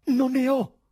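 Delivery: high, loud, despairing cry; frantic high-pitched protest; desperate shout. A narrator's reading. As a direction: shout your innocence in despair. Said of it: "gridò disperato il presidente".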